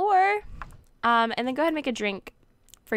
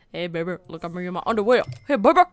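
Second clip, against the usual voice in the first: hammed-up come-on, jumping louder; mock flirting; 2 semitones lower, 6 dB louder, much faster than usual. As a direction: play an over-the-top flirt, building in volume.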